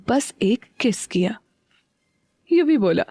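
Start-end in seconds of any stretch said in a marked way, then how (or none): 0.1-1.4 a slow, voiceless murmur; whisper. Acted. Teasing whisper to husband.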